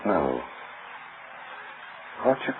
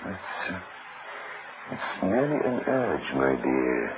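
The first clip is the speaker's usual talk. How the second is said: low, soft and slow, deadly calm; murderous calm; 8 semitones lower, 8 dB quieter, slower than usual. Acted. Husband reaching for his wife's throat, slow hushed menace. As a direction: let the threat creep out slowly and quietly. Husband about to strangle wife.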